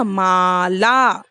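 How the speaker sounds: माला slowly with long aa; long vowels. Shown as a demonstration. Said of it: "sound लंबी हो रही है".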